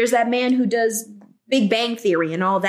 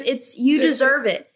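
ordinary talk, nothing marked

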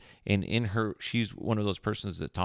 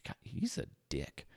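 muttered aside; 5 semitones lower, 10 dB quieter than usual. Acted. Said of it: "aside about the cowboy".

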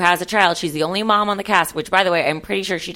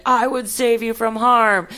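heroic manly voice; 4 semitones higher, slower than usual. Retold or imitated. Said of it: "let him be like".

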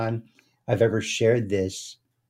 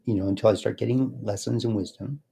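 normal talking voice

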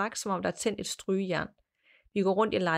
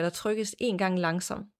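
ordinary delivery

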